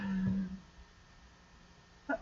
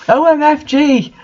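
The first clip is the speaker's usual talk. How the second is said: mock yell; 7 semitones higher than usual. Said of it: "I yielded as I jumped up".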